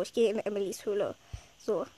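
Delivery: usual speaking voice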